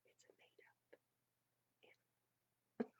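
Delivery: confiding whisper; whispered aside. Real, not acted. Reader telling listeners jackalopes are fake, whispered. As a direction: let them in on a secret. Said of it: "aside to listeners".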